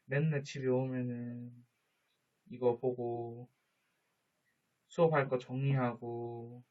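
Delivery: usual speaking voice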